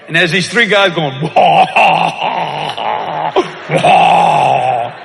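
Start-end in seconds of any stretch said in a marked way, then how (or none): 1.2-5.0 loud mocking baby wail. Guys on plane mocked with loud wailing. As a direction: wail like crybabies.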